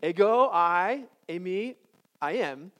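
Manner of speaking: Ego stretched out slowly and loud, then eimi; a teacher glossing each Greek word slowly; slow breakdown. Shown as a demonstration. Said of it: "Your turn".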